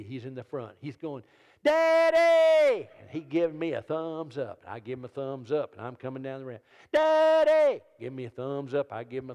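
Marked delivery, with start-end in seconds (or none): 1.6-2.9 put-on high, loud child's shout; excited little boy's yell; kid yelling. Little son yelling at airport. 6.9-7.8 put-on high, loud child's shout; excited little boy's yell; kid yelling. Little son yelling at airport.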